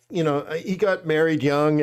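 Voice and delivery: normal talking voice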